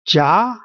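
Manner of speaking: jia gliding upward; rising jia, like a question; second tone. Shown as a demonstration. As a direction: say jia with the pitch climbing to the end. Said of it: "let me read it".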